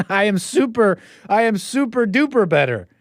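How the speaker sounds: high, laughing boast, pitch way up; mock smug chatter. Host mocking a chat member's boast, high and laughing. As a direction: gloat like a proud troll in chat. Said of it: "This person is doubling down".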